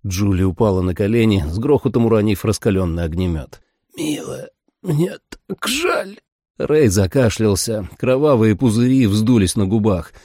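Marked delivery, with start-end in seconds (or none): 4.0-6.2 high, drawn-out, tearful apology; dying man's remorse. A narrator's reading. Wounded father apologizing to his daughter, weak and tearful. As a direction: labour the words as if near death.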